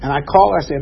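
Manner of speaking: ordinary talk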